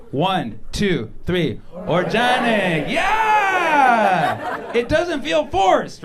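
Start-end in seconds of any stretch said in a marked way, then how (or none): 1.7-2.9 crowd chant of "orjanic"; shouted organic. Shown as a demonstration. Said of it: "feel how good it feels to say it". 2.9-6.0 high, loud, slow triumphant yell; playful triumphant shout to the crowd. Real, not acted. Triumphant cry after the chant.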